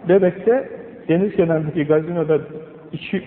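ordinary delivery

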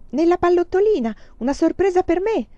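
put-on high eager child's voice; a little boy hoping for a surprise; high, hopeful and eager. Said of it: "chiese speranzoso".

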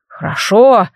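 gurgle the word out; gurgling water spirit. A narrator's reading. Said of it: "побулькал Водяной".

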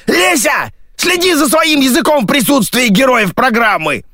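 very high, shouted rebuke; scolding. A narrator's reading. Radio voice reprimands Lesya before guests, high scolding shout. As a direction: shriek a telling-off.

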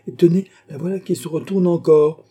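high, loud and urgent; excited exclamation. Acted. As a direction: cry out at the sighting.

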